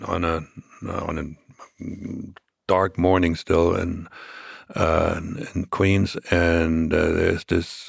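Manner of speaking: ordinary delivery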